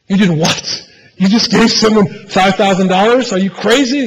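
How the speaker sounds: incredulous boss yelling at the clerk; agitated store owner. Retold or imitated. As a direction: play the owner getting all worked up. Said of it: "He's getting all agitated and worked up".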